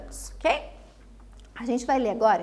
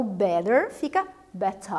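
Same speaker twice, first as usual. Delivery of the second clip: British "better" with a crisp T and the ending said like "a"; British accent; 5 semitones lower, slower than usual. Shown as a demonstration. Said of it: "o T é realmente pronunciado como T e o E R fica como A". ("better" com sotaque britânico, T bem pronunciado e final como A)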